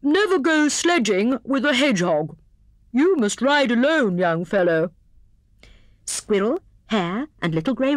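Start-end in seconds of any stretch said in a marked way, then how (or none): 0.0-2.4 high hare voice setting it straight; corrected motto. A narrator's reading. Hare restates motto to hedgehog, corrected. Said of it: "he corrected himself hastily". 2.9-4.9 severe. A narrator's reading. Hare orders hedgehog to ride alone, severe. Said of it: "said he severely".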